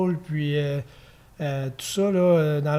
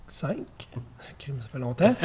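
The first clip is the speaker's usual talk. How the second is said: murmure rieur pour soi; aparté chuchoté; 3 semitones lower, 5 dB quieter than usual. Real, not acted. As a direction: glisser ça tout bas en riant. Aparté en cherchant l'année. (petit aparté chuchoté)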